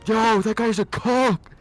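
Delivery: slow, loud, high jeering yell; shouted taunt. Retold or imitated. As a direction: yell it across the room to mock him. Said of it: "the one guy's like".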